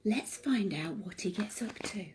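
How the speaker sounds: secret whisper leading into the story; soft unvoiced whisper. Real, not acted. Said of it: "whispered aside before reading".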